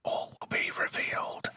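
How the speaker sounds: hushed teasing whisper. Real, not acted. Host teasing the episode's secret in a whisper.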